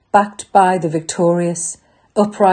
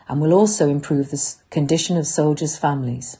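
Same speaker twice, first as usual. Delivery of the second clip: ordinary talk, nothing marked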